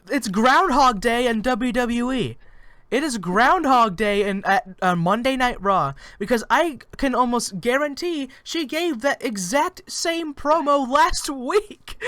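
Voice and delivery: high, loud, incredulous outburst; exasperated rant. Real, not acted. Co-host ranting about recycled wrestling promos, exasperated.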